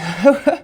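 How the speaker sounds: rising laugh after exertion; breathless laugh. Real, not acted. Laughs after push ups.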